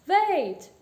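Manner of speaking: loud, high call to stop. A narrator's reading. Shopkeeper calls after a customer leaving the shop, calling out. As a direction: shout after someone leaving. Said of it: "the shopkeeper called out".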